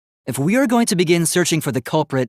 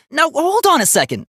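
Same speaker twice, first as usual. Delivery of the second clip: higher, louder objection to cut them off; raised protest; 10 semitones higher, 3 dB louder than usual. Acted. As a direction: interrupt firmly, voice rising. Robot protests being accused.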